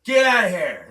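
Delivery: loud, barked guard's order; mock guard's yell; security guard. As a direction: imitate a guard shouting someone away. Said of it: "security guard's like".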